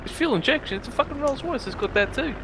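high sneering retold voice; scoffing rich bloke. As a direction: wave it off in a high voice. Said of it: "He goes".

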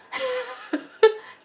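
high-pitched burst of laughter. Real, not acted. Storyteller amused by the couple's cries, high laughter.

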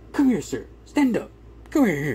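high, loud summons across the courtroom; commanding call. Retold or imitated. Attorney summoning the father to stand, loud command.

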